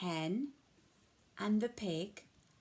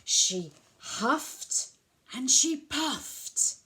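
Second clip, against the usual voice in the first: loud, effortful huff and puff; 4 semitones higher, 6 dB louder, much slower than usual. Acted. Reading to kids, huffing and puffing aloud. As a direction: strain the words out loud and high.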